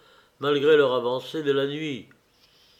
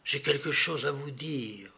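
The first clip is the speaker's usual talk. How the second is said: murmured; 7 dB quieter than usual. A narrator's reading. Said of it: "murmura-t-il".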